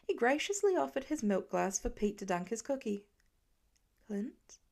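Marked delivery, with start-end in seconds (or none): 4.1-4.6 much softer, almost silent; a silent worried question across the room; mouthed. A narrator's reading. Wife silently asking her husband what's wrong, mouthed. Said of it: "Laura mouthed".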